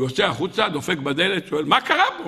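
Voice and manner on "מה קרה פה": high, startled query; alarmed question. Acted. Young man knocks and asks what happened, alarmed. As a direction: ask urgently with the pitch jumping.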